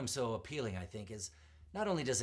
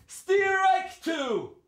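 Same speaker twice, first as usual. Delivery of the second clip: much higher ringing umpire shout; umpire's call; 12 dB louder than usual. Acted. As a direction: belt out the call. Umpire calls second strike.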